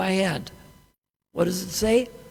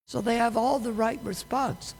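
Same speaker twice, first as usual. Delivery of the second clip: ordinary talk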